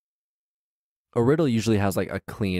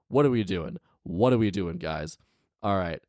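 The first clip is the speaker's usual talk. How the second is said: ordinary talk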